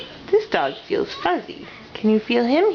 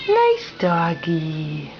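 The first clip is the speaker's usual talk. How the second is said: low, slow and gentle; reading to a toddler; 10 semitones lower, much slower than usual. Shown as a demonstration. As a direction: coax it out slowly for a child.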